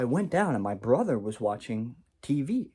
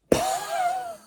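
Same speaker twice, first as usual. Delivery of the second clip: very high-pitched sudden laugh; mimicked burst of laughter. Brother hears about the mushrooms, mimicked burst of laughter. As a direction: imitate someone cracking up instantly. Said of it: "he just immediately goes".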